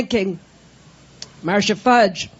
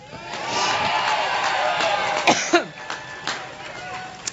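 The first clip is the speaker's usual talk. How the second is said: chesty cough; 6 dB quieter than usual. Real, not acted. Coughing fit during speech.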